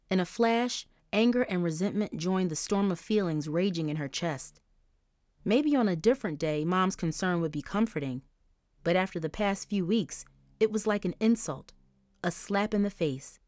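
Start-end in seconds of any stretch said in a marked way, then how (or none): none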